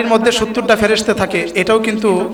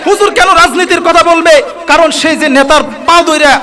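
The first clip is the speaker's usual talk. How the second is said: higher, louder fiery preaching; preaching at full force; 6 semitones higher, 4 dB louder than usual. Acted. Preacher rebukes corrupt followers, fiery higher and louder. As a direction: drive it home to the crowd with fire.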